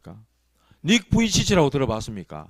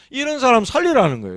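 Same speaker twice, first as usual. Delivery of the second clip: 살리라는 punched higher and louder, falling at the end; emphatic declaration; 6 semitones higher, 3 dB louder than usual. Shown as a demonstration. Preacher to congregation, declaring loudly. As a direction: proclaim 살리라는 with force to the congregation. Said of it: "repeats the line in a new way".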